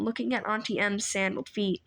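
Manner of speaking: ordinary delivery, nothing marked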